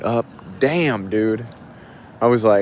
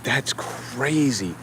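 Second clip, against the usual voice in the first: stunned hush; 2 semitones higher, 9 dB quieter, much slower than usual. Real, not acted. Stunned after seeing violence.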